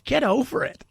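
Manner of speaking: normal talking voice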